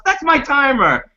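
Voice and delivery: high-pitched imitation of an indignant grandma; mock outrage, pitched right up; outraged grandma. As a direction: exclaim it high, as grandma catching a thief. Grandma's outraged discovery, acted out.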